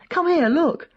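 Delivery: high, eager beckoning; eager call. A narrator's reading. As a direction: wave the listener over, keen. Koch eagerly shows the garden.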